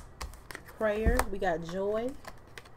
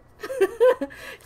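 high laugh; 14 semitones higher, 8 dB louder than usual. Real, not acted.

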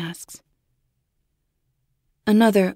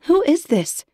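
higher, alarmed question; frightened demand; 10 semitones higher, 3 dB louder than usual. Acted. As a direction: snap it out in fear. Babysitter frightened by caller.

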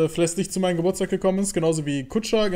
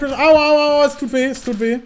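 mock pain; 8 semitones higher, 4 dB louder, slower than usual. Hit in game, mock pain.

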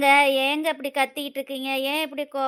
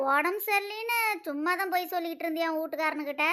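normal talking voice

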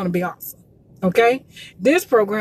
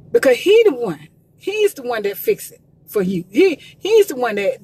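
usual speaking voice

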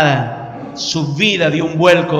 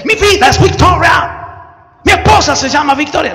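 high, loud, joking preacher; laughing preacher shout; 9 semitones higher, 6 dB louder than usual. Retold or imitated. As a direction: ham up the preacher's boast. Preacher's exaggerated claim. (predicador exagerado, gritando entre risas)

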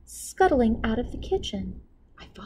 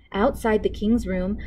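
usual speaking voice

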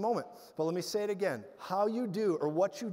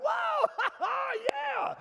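high, loud whoop breaking into laughter; hysterical laughing yell; 4 dB louder than usual. Retold or imitated. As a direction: yell and laugh through grabbing pain. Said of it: "the pain would grab harder".

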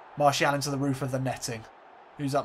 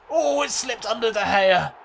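louder, higher surprised call; excited; 8 semitones higher, 6 dB louder than usual. Real, not acted. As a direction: react as the ball slips in. Goal reaction in commentary.

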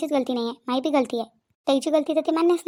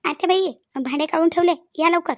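ordinary talk

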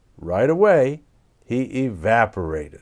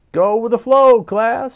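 bright teacher call, louder and higher; happy; 10 semitones higher, 8 dB louder than usual. A narrator's reading. Teacher cheerfully urging her class onward, happy and loud. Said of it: "said Miz Frizzle happily".

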